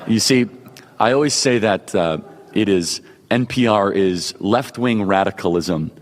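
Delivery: slow, smooth public-radio parody; measured mock NPR host, drawing out the phrases. Host parodies left-wing radio for supporters, NPR voice. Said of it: "do that in my N P R voice".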